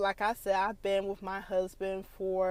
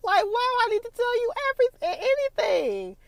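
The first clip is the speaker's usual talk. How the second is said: high, louder, fast indignant rant; hammed-up outrage; indignant sass; 6 semitones higher, 6 dB louder than usual. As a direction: huff it out, louder and higher.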